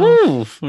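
high, loud cheering woo; excited whoop. Real, not acted. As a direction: let out a delighted whoop. Cheers at partner's point.

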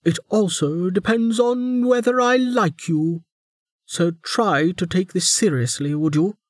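high, pointed warden; prison guard. A narrator's reading. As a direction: press him to behave. Warden voiced.

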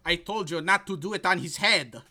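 hammed-up German accent scolding. Ralphie scolding his bodyguard in a hammed-up German accent. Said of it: "my attempt at a German accent".

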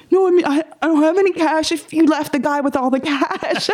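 high-pitched comic excuse; hammed-up flustered denial; mock protest. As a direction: plead it high, on the edge of laughing. Acts out pleading with robber.

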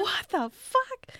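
high drawn-out disbelief; incredulous. Real, not acted. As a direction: stretch it out, baffled. Incredulous reaction.

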